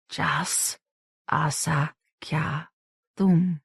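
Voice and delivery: soft broken whisper; whispered syllables. A narrator's reading. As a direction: breathe the word apart slowly. Whispering the word in mind.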